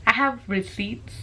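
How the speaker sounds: usual speaking voice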